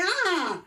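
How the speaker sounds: high answering scream from the patient; startled patient's mock yell. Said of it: "And he screamed".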